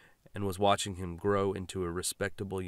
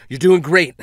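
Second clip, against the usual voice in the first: raised, strained husband's voice; tense husband; 8 semitones higher, 16 dB louder than usual. Acted. Driver answering his wife in the back, strained. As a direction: hold it together while driving.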